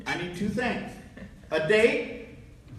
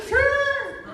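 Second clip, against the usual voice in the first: very high comic squeak; mock timid falsetto yes; squeaky agreement. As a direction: pipe up way too high. Scared, agrees to be waiter.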